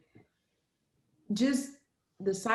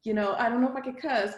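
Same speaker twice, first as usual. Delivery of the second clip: usual speaking voice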